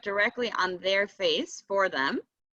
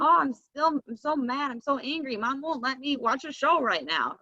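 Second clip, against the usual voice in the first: mock angry kid; 5 semitones higher than usual. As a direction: act out a kid fussing at the mirror. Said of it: "and say".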